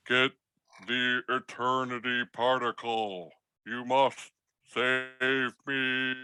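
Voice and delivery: slow, soft, fading robot voice; dying robot plea. Acted. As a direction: gasp out the last order as batteries fail. Dying robot's last words.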